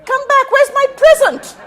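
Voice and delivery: high, loud call to come back; high, loud laughing call after the guest. Acted. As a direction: shout after her to stop. Calling the departing guest back.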